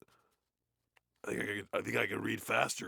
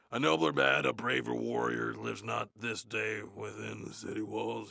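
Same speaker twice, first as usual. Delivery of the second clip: loud, declaiming Shakespeare; dramatic reading; 6 dB louder than usual. Acted. Reading the play aloud on mic, loud grand declaiming. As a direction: proclaim it like a stage actor.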